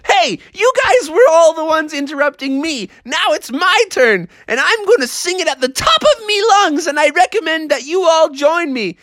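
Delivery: high, insistent frog voice; defiant frog. Acted. Froggy insists it's his turn to sing, defiant. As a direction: declare it high, standing your ground. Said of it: "And Froggy's like".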